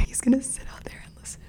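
fast hushed whisper; real whisper. Real, not acted. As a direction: whisper so he won't hear. Whispering about brother nearby.

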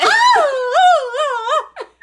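high hammed-up sobbing wail with a laugh in it; slow, high, mocking sob sounds. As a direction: fake a hysterical sob, laughing through it. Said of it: "she is sobbing".

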